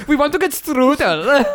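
very high, silly German voice; mock German accent. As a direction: squeal it in cartoon German accent. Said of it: "the crew was mostly German".

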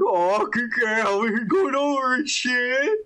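mock a delighted, giddy greeting; giddy and happy. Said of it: "all giddy and happy over".

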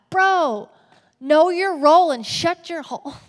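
hammed-up scolding shout at a pushy friend; mock indignant disciple. Disciples scolding James and John in a hammed-up yell. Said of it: "I could just hear 'em now like".

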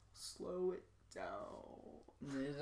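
very slow, drawn out. Shown as a demonstration. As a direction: stretch every word way out. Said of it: "I think I'm just speaking too quickly".

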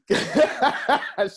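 real high-pitched burst of laughter. Real, not acted. Laughing while retelling.

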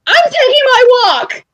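high, huffy announcement; huffy exit line. Retold or imitated. Fan storming out of Raw, huffy high line. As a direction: storm off with a pouty flourish. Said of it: "having a full-on tantrum".

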